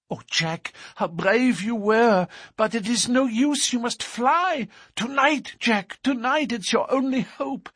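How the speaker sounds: very high, frightened woman's voice; frantic urging. A narrator's reading. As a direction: urge a sweetheart desperately to flee. Frightened sweetheart urges him to flee.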